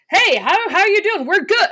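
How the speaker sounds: mock over-loud, bubbly American hello; Brandon. Greeting coffee shop staff, loud and over-friendly. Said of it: "Brandon will go".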